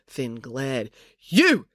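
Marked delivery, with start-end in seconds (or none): 1.2-1.7 high, sudden angry yell. A narrator's reading. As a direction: yell to single out the heckler. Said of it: "he yelled at the jocular man".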